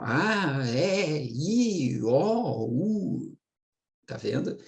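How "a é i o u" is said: a, é, i, o, u sung out rising, each vowel open and clear; vowels demonstrated. Shown as a demonstration. Teacher to class, demonstrating a é i o u clearly. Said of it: "Então, ó".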